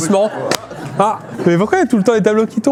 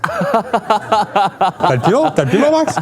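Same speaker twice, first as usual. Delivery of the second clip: laughing; much slower than usual. Real, not acted. Teasing Max, laughing.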